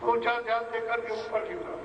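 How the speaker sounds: soft, hushed meditation guidance; guided meditation. Real, not acted. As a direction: quietly guide their attention upward. Begins guided meditation instruction.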